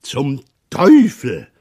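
loud, high exclamation of a curse; sudden cry. A narrator's reading. Father suddenly curses while out fishing, crying out. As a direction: burst out with a drawn-out, high curse. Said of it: "rief plötzlich Vater Roland".

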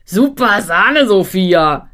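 put-on slow, loud boyish bawl. A narrator's reading. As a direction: bellow it like a goofy boy. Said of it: "grölt Hannes".

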